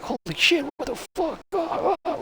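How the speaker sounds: very high, pained mock wailing; swearing and yelling. Patient in recovery room in wrecking pain, mock yelling. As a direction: curse and moan in hammed-up agony. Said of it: "I'm swearing and yelling".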